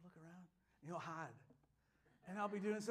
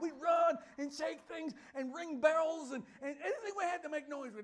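excited shout; 10 semitones higher, 8 dB louder than usual. Real, not acted. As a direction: shout it with joy.

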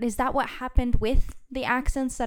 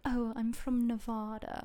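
slow, softer mock-proper accent with "ne-VAH-da"; too-proper accent; 6 dB quieter than usual. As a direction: put on an overly proper accent. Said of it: "it sounds too proper".

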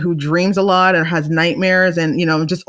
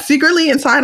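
ordinary talk, nothing marked

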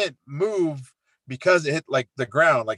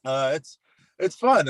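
ordinary talk

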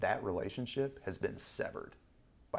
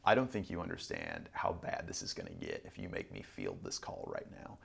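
low urgent plea; 12 semitones lower, 3 dB quieter than usual. Retold or imitated. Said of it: "And I was like".

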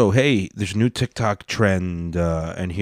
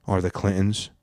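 ordinary talk

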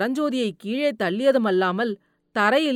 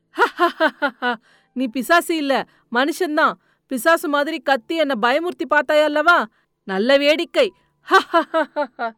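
louder, higher booming laughter through the words; loud laughing shout; 4 semitones higher, 4 dB louder than usual. Acted. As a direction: roar with laughter at the prank turned back. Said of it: "உரத்த குரலில் சிரித்துக்கொண்டே கூவினான்".

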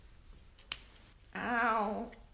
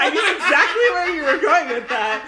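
laughing; 7 semitones higher, 11 dB louder than usual. Real, not acted. Laughing at Speedo mixup.

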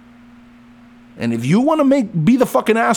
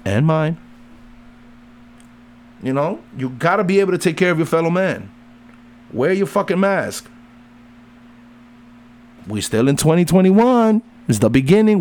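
ordinary delivery

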